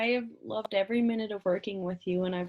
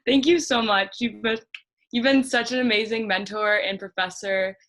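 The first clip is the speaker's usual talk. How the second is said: louder, welling-up gratitude; emotional, near-crying thanks; tearful thanks; 5 dB louder than usual. Real, not acted. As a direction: thank her while fighting back tears. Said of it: "I'm literally gonna cry".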